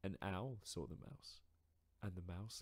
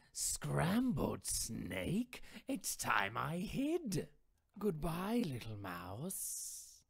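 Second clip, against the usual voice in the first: slow, louder, startled snake; alarmed snake; 8 semitones higher, 9 dB louder, much slower than usual. Acted. Snake sliding off in alarm, slow and louder. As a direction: snake deciding to hide.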